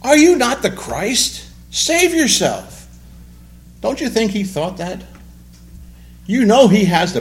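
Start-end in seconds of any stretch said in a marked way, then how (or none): 0.0-2.9 high, loud, slow jeering taunt; mocking taunt. Criminal on the cross taunting Jesus, mock jeering. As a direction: sneer it up at him. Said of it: "was hurling abuse".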